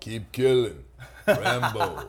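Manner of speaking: Stallone voice. Retold or imitated.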